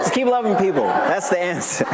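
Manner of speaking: high, laughing through the words; giggly punchline. Real, not acted. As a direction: let the laugh spill into it.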